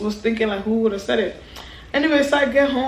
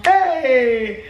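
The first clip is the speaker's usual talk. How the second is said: long, drawn-out "hey" of dread; storyteller's stretched cry of being caught; dismayed exclamation; 2 semitones higher than usual. Retold or imitated. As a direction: wail it in comic dismay. Caught by the principal, retold.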